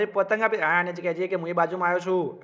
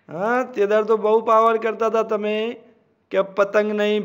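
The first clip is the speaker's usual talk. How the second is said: usual speaking voice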